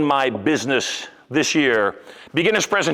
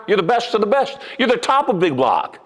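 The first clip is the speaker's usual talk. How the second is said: ordinary delivery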